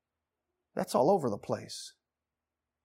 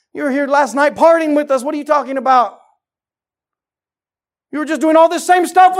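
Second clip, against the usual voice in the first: loud, high, scoffing partiers; mocking friends; 12 semitones higher, 14 dB louder than usual. Lot's friends mocking him for preaching, loud and scornful. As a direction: scoff at him like old party buddies.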